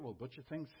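ordinary talk

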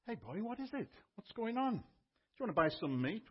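a shopkeeper coaxing a dog with a lifted voice; butcher talking to a dog. Retold or imitated. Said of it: "butcher looks at him".

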